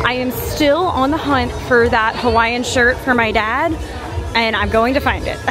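ordinary talk

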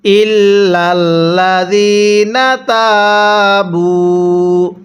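ইল্লাল্লাযিনা তাবু joined, chanted slowly; slow recitation. Shown as a demonstration. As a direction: recite the two words together slowly. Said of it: "মিলাই পড়ুন".

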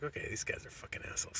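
whispered angry aside under his breath; muttered aside. Retold or imitated. On the field, fed up with the other coaches: whispered aside. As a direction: hiss it to yourself, fed up.

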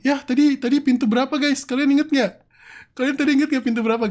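loud, high, urgent question to chat; flustered high-pitched appeal; panicked asking. Real, not acted. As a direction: raise your voice in a flustered rush. Panics over forgotten door number.

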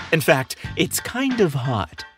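plain reading voice

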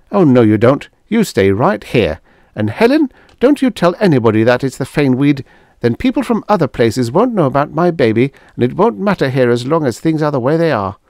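firm insistence. Acted. Dora insists, bossing.

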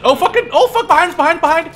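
very high, loud warning cry; alarmed shout. Real, not acted. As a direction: yell that the enemy is behind. Enemy behind them.

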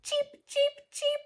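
tiny high, soft cheeps; chick cheeping. Acted. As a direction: imitate baby chicks. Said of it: "They go".

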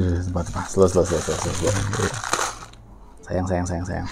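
low murmured caution; hushed careful. Real, not acted. Food about to spill, careful.

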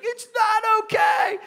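put-on high, drawn-out child's wail; wailing protest. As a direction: imitate a small boy howling back, slowly. Crying boy rejects the comfort.

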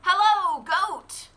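high, bright greeting as the duck; cheerful duck. Acted. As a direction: call out hello as a friendly duck. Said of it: "said Duck".

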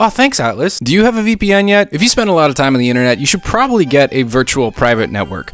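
loud, fast, pumped-up sponsor pitch; excited ad read. Acted. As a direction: sell it like a hyped YouTuber.

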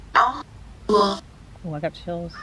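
ordinary talk, nothing marked

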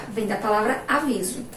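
ordinary talk, nothing marked

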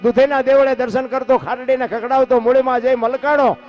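loud rhythmic chanted recitation; declaimed folk verse. Acted. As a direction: roll the verses out like a bard. Recites Bhikhabhai's earthquake verse.

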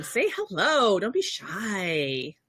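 ordinary talk, nothing marked

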